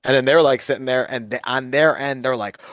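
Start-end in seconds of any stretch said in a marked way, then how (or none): none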